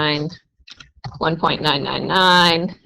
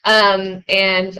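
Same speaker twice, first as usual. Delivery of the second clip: normal talking voice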